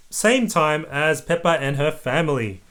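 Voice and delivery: plain reading voice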